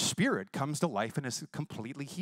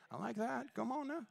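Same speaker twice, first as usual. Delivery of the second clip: soft approval; 8 semitones higher, 10 dB quieter than usual. Retold or imitated. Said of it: "I said".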